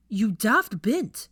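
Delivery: loud, slow exasperated scolding; angry scolding. A narrator's reading. Draco scolding Hermione loudly and slowly after chasing her. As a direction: bite out the insult in exasperation.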